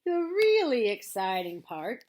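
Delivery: loud, drawn-out donkey lament; slow, mournful emphasis; melancholy Eeyore. A narrator's reading. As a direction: stretch the words with heavy irony. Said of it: "in his most melancholy voice".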